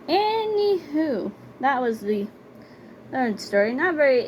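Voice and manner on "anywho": slow, much higher drawn-out anywho; lilting drawn-out transition word; sing-song anywho. Real, not acted. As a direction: stretch it out playfully to change topics. Drawn-out topic change.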